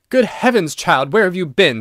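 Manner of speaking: a sharp, loud, high rebuke; father angrily scolding his lost daughter; angry. A narrator's reading. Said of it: "said Mister Otis rather angrily".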